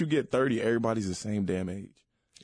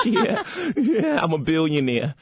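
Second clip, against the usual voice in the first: rapper's big signature laugh, then a boast; loud, high braying laugh into a brag; 7 semitones higher, 6 dB louder than usual. Acted. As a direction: laugh like the rap mogul, loudly. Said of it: "When you do [name]".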